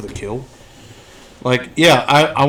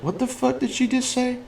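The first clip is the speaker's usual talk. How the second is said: baffled; 11 semitones higher, 7 dB quieter than usual. Retold or imitated. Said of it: "I was like".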